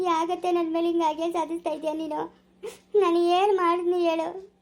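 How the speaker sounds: tearful, pleading, voice full of sobs; crying plea. Acted. Accused by sister-in-law, pleading. (ಅಳುತ್ತಾ ಬೇಡಿಕೊಳ್ಳುವ ಧ್ವನಿ)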